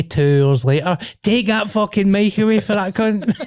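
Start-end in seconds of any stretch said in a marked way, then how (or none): 1.2-3.3 laughing mock complaint. Quoting listeners' complaint.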